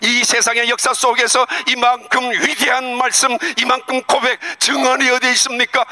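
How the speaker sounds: high, ringing impassioned preaching; fervent preacher's climax; preaching at full force. Acted. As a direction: pour it out fervently.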